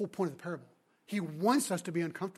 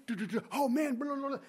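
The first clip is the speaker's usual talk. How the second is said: excited chatter; 4 semitones higher than usual. Acted. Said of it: "they're talking like crazy".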